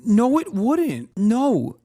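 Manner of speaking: high, drawn-out objection; indignant high-pitched contradiction; emphatic protest. Real, not acted. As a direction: cry out in disbelief, slow and high. Rebuts the clip in protest.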